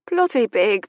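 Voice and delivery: Irish "Bloody big" with a hard p-like B; mortified Irish repetition of "Bloody big"; Irish accent. A narrator's reading. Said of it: "A very ripe Irish accent".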